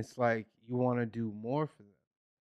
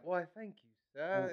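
polite, higher British thank-you; British accent; 4 semitones higher, 9 dB quieter than usual. Retold or imitated. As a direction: imitate a kid being proper in British. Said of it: "talk properly in the accent".

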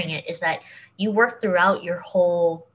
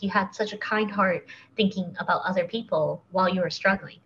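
ordinary delivery

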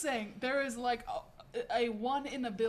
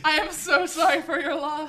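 laughing mock condolence; 4 semitones higher, 11 dB louder than usual. Mock condolence over dead guinea pig.

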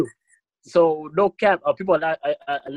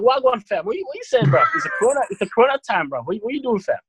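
high mock roadman slang voice; imitating mum in roadman London slang; london roadman accent; 6 semitones higher than usual. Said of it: "she was like".

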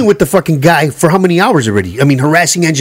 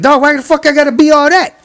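retold mad reply; 9 semitones higher than usual. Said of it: "I got mad".

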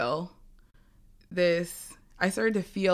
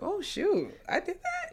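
mock disbelief; 12 semitones higher, 3 dB quieter than usual. Surprised at own past self.

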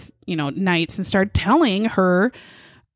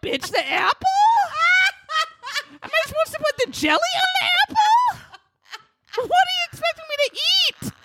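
much higher, hammed-up yelling husband; furious husband rant. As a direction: rant at the wife over apples and jelly, shrieking.

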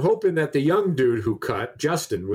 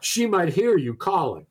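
pissed clerk; 6 semitones higher than usual. Retold or imitated. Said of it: "he's pissed now".

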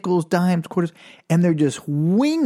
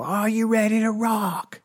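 rock band hype; 7 semitones higher than usual. Retold or imitated. Band asking a school assembly, hyped-up rock call. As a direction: imitate a frontman working up the crowd. Imitating a band hyping the crowd.

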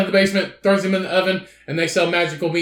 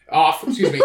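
ordinary delivery

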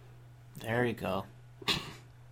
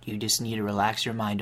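usual speaking voice

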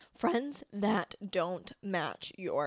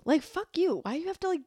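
higher, punchier repeat with force on you; emphatic fuck you; 8 semitones higher than usual. Real, not acted. Telling the friend off, higher and emphatic. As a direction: hit it harder the second time.

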